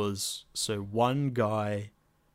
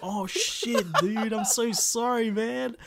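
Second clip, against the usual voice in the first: high apologetic bloke voice; character voice; 12 semitones higher, slower than usual. Retold or imitated. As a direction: squeak out a guilty apology.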